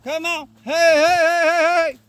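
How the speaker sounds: high, loud rapid hey calls; driving calls. Real, not acted. Trainer pushes the pup forward with loud rapid calls. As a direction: drive the pup forward with quick shouts. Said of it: "My cadence is gonna pick up".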